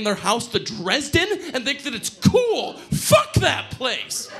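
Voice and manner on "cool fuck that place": slow, scornful laugh through it. Real, not acted. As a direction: spit it out with a laugh. Bitter laughing outburst.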